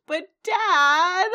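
high, loud, drawn-out whine; imitating a spoiled child's loud protest; whiny protesting kid. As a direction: wail it like a thwarted daughter. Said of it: "And she goes".

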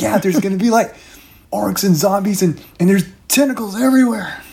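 high, hurried doom-prophet babble; babbling Shauna. Acted. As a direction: rattle off the horrors wide-eyed.

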